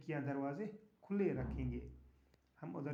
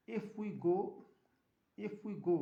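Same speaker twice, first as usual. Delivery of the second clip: put-on 1 anxious child; 5 semitones higher, 5 dB louder, slower than usual. Nina worried in story.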